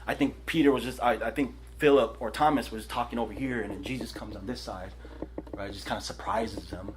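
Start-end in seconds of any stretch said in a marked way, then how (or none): none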